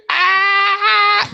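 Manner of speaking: very high, loud sustained squeal; held-back excited squeal. Retold or imitated. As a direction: squeal it, reined in from a full yell. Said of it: "can't do my actual obnoxious yell".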